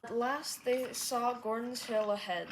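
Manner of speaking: ordinary delivery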